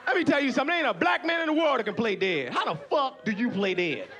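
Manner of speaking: ordinary talk, nothing marked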